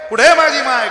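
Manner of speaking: loud, high wail on "कुठे", falling at the end; desperate sobbing question to the crowd; crying for mother. Acted. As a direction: cry "कुठे माझी माय" as a lost child wailing. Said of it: "रडत होतं".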